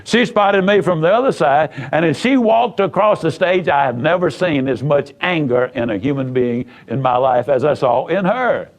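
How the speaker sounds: normal talking voice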